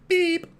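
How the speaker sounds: loud electronic-style beep, high and level; imitated tape beep. Host claims he spoke German like that, high beep. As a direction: beep like a recorded language lesson. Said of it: "speaking like that".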